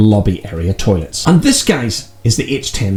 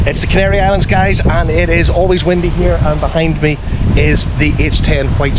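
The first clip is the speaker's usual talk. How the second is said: raised voice over roaring wind; shouting over wind; 7 semitones higher than usual. Real, not acted. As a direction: project hard to be heard outdoors. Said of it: "I hope you can hear me over the wind noise".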